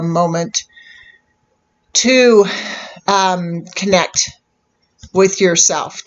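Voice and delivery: calm meditation guide with long pauses; unhurried phrases, leaving space to settle between them; guided meditation. Real, not acted. Host guiding viewers to close their eyes and connect, calm meditation voice.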